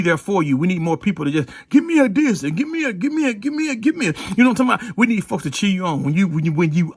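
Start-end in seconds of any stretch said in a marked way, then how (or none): none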